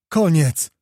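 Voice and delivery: forceful falling exclamation; emphatic repeat. A narrator's reading. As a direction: shout it out as the final word. Lookout repeats battle is over.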